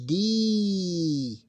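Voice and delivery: "D" held as a long, loud, high ee; long sound. Shown as a demonstration. Teacher showing students a long sound, drawn out loudly. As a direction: stretch the vowel of "D" right out. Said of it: "it is long sound".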